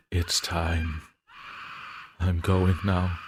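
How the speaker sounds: hysterical surrender. Acted. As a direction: break down as you give in. Diarist giving up, hysterical.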